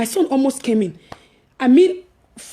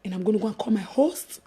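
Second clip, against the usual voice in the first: whispered; 5 semitones lower, 6 dB quieter than usual. Retold or imitated. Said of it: "I said".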